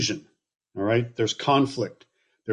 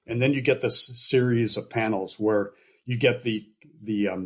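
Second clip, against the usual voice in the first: ordinary talk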